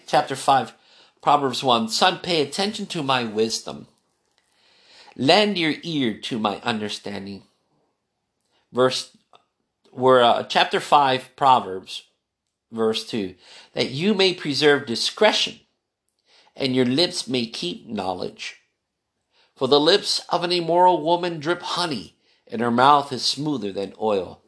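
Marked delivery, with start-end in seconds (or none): 1.9-7.4 lower, measured reading of Proverbs; a solemn Bible reading to a child; scripture reading. Acted. Reads Proverbs aloud to son. 13.7-24.4 lower, measured reading of Proverbs; a solemn Bible reading to a child; scripture reading. Acted. Reads Proverbs aloud.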